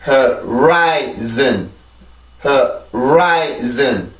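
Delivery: 호라이즌 stretched out syllable by syllable; slow it right down to model the word; slow careful demo. Shown as a demonstration. Teacher modelling the word slowly for learners. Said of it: "앞에부터 차근차근 발음합니다".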